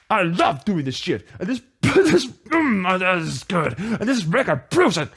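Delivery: ordinary talk, nothing marked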